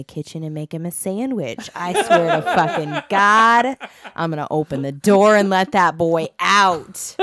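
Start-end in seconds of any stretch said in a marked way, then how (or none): 1.7-7.2 loud, laughing threat; laughing exasperation. Real, not acted. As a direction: joke it out through laughter. Exasperated mom joke.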